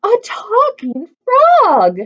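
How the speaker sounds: high, loud, slow marvelling; delighted shout. A narrator's reading. Martha amazed by a talking frog, loud and high. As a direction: stretch it out in wonder. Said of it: "she shouted".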